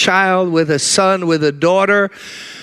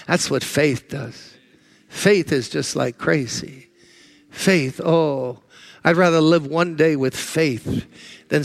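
hushed preaching; 5 semitones lower, slower than usual. Real, not acted. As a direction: bring it down to a hushed, slow plea. Soft slow preaching passage.

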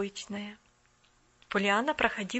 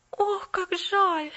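moaned; 8 semitones higher, 5 dB louder, much slower than usual. Acted. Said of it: "простонала Полианна".

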